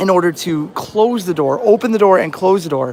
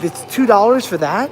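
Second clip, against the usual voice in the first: raised pitch, rising on THAT; retold incredulous question; 3 semitones higher than usual. Friend questions the cheap Big Gulp price, incredulous. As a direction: imitate a disbelieving question, lifting at the end.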